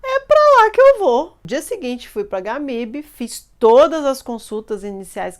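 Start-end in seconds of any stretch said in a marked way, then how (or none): 0.0-1.4 high, loud, emphatic declaration; determined cry. Retold or imitated. Daughter telling her mother, loud and high, she will go. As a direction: announce it like a vow, ringing out. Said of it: "Aí eu falei".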